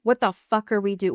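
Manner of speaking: normal talking voice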